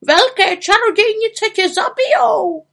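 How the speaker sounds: very high, loud panicked squawk; alarmed bird voice pitched right up; croaking raven. A narrator's reading. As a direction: squawk a frantic warning. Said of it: "krákal Abraxas".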